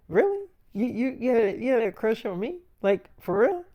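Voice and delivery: imitating her high, rising, stammered surprise; high-pitched mock astonishment, questions swooping up; surprised disbelief. Actress hears storyteller's crush confession, imitated surprised disbelief.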